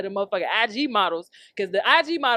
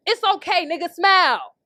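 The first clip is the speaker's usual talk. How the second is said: chirpy slogan; 6 semitones higher, 3 dB louder than usual. Shown as a demonstration. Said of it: "I should make that t-shirt".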